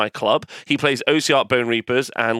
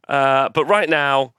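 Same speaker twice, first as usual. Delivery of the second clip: ordinary talk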